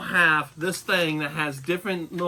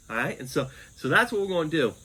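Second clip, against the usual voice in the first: normal talking voice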